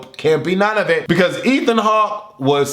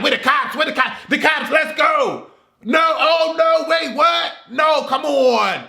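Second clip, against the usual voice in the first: excited yelling; 9 semitones higher than usual. Retold or imitated. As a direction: shout for the cops, then plead no, slowing down. Reacting to the film's climax.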